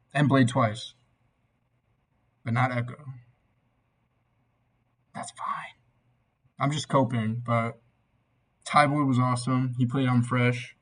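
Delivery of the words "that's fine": whispering. Real, not acted. Coping aside after missing show.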